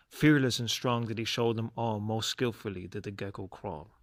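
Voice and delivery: usual speaking voice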